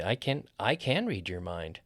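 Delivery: CAN full and weighted; stress on CAN. Shown as a demonstration. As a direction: lean on CAN to insist on the surprising ability. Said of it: "You're stressing the can".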